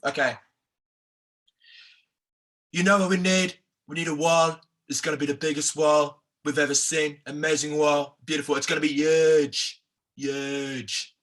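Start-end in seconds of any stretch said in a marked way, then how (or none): 2.7-11.1 put-on presidential impression, huge said as "yuge"; Donald Trump. Host trying the impression himself, Donald Trump voice. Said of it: "a Donald Trump on What Now".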